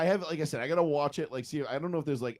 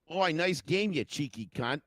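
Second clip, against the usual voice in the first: higher, hammed-up Aussie accent; mock Aussie banter; Australian accent; 5 semitones higher, slower than usual. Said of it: "as the Aussies would say".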